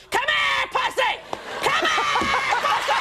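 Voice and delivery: father yelling for the lost cat; calling the cat. Retold or imitated. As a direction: shout for the cat. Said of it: "run down the street going".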